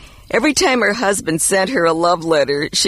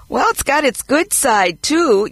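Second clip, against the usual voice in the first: put-on child voice; 7 semitones higher than usual. Boy answers mother about report card.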